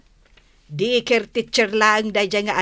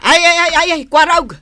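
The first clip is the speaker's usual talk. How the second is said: angry cries; 6 semitones higher, 6 dB louder, faster than usual. Acted. Quarrel turning physical.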